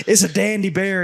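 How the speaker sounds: high-pitched, delighted retold praise; excited praise. Guide praising the hunter's bear, excited. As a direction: re-enact an excited verdict. Acting his excited answer.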